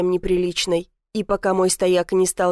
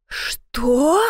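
higher, rising outraged question; indignant exclamation; 4 semitones higher than usual. A narrator's reading. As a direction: exclaim it in affront, lifting the end. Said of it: "возмущённо воскликнула она". (возмущённый возглас)

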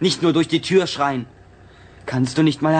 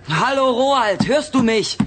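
slow, higher calling out his name; 6 semitones higher, slower than usual. Acted. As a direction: stretch the call so it carries. Calling to him through the door.

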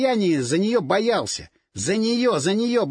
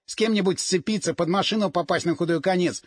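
ordinary delivery

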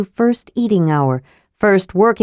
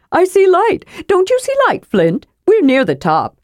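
much higher, quick eager cry of relief; hurried; 12 semitones higher than usual. Acted. Choked kender to dwarf, hurried and much higher. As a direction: rush to distract him, bright and high. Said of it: "Tass added hurriedly".

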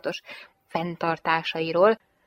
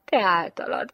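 plain reading voice

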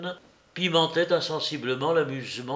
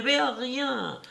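noisy insistence; 10 semitones higher than usual. A narrator's reading. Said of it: "les affirmations bruyantes de l'employé".

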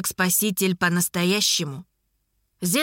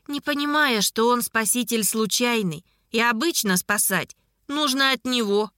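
ordinary delivery, nothing marked

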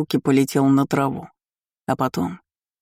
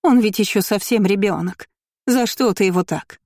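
higher, plaintive scolding; reproachful mother; 5 semitones higher than usual. A narrator's reading. Aristocrat defends her little boy, reproachful. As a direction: plead the child's case with reproach. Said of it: "полный осуждения".

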